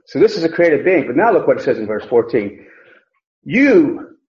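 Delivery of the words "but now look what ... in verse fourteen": ordinary talk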